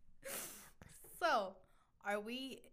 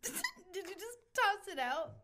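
laughing; 8 semitones higher, slower than usual. Real, not acted. Laughing disbelief at question.

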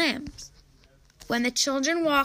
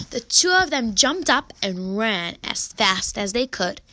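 plain reading voice

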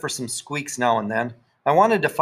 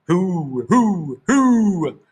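mock spooky hooting; 6 semitones higher, 4 dB louder, much slower than usual. A narrator's reading. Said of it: "kids imitate the scary noise".

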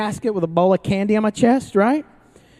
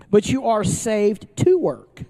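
ordinary delivery

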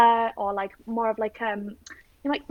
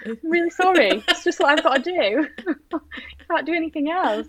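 higher, hammed-up sorry with a fake sob; mock tearful apology; 6 semitones higher than usual. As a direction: pretend to be sorry, almost crying for laughs. Mock apology to stepdad, laughing.

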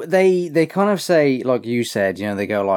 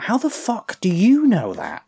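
shocked disbelief; 9 semitones higher than usual. Retold or imitated. Acted shocked quote from show.

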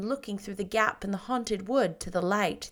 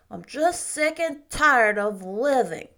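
put-on slow, louder, gloomy child; sulky boy; 3 semitones higher, 6 dB louder than usual. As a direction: drag it out like a moping ten-year-old. Davy complains.

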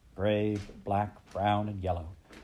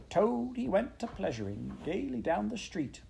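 high-pitched singsong weasel; high squeaky voice; 11 semitones higher than usual. A narrator's reading. Chief Weasel performing his song about Toad, high and squeaky. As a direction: squeak out a teasing little ditty. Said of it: "began in a high, squeaky voice".

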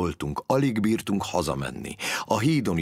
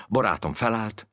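ordinary delivery

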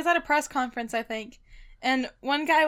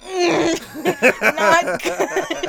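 laughing; 3 dB louder than usual. Retold or imitated. Voicing [name]'s face reaction.

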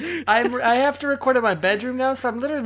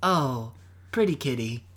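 low, breathy sexy coo; sultry cooing; 7 semitones lower than usual. Acted. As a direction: coo at the cat suggestively. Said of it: "That sounded very sexual".

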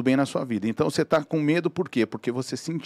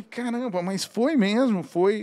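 surprised realization; 7 semitones higher, slower than usual. Retold or imitated. Realizing the scorer was him.

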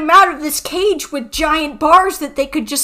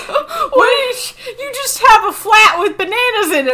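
laughing disbelief; 6 semitones higher than usual. Real, not acted.

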